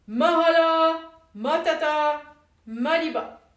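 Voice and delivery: very loud slow incantation; cried out. A narrator's reading. Woodcutter stopping the mill, loud slow shout. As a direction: shout the magic words. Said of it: "s'écria".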